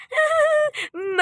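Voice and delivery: very high, drawn-out laugh; mocking cackle. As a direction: cackle on despite the scolding. Laughs as mother scolds the mockery. (ऊँची, लंबी चिढ़ाती हँसी)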